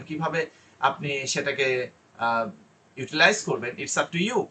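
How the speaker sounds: ordinary delivery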